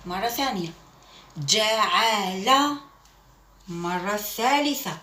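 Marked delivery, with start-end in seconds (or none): 1.4-2.8 slow syllables. Shown as a demonstration. Teacher reading جعل slowly to young pupils. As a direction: stretch each syllable of جعل slowly for the class. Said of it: "teacher models reading slowly".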